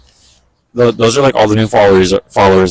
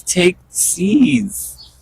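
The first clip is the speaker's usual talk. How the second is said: coaxing birds; 9 semitones higher than usual. Real, not acted.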